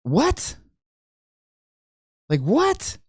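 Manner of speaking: high incredulous disbelief; incredulous what. Real, not acted. Stunned by the Fed chair's complacency, an incredulous high what. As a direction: squeak out what in shock. Reacting to Powell's words.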